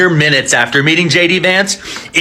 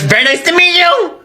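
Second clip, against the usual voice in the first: mock polite Vance; 9 semitones higher than usual. Mocking Vance greeting.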